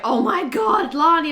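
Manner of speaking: high, loud excited greeting; excited squeal. Retold or imitated. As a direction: gush like a starstruck fan.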